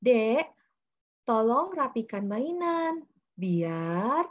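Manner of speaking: put-on slow, drawn-out coaxing of a small child; simple request to child. Shown as a demonstration. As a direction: ask the child slowly and simply. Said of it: "nanti kita mungkin misalnya gini". (membujuk anak dengan pelan, kata demi kata)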